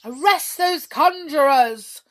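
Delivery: loud, drawn-out royal command; awful commanding voice. A narrator's reading. King ordering his soldiers, in an awful voice. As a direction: thunder the order, high and slow. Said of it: "said the king in an awful voice".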